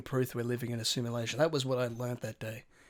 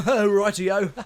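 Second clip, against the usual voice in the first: loud, high laughing exclamation; cackle, then call it out high and loud; imitated catchphrase; 6 semitones higher, 9 dB louder, much slower than usual. Mimicking the odd bus stranger's catchphrase, loud and high. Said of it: "where he, he'd say a kind of".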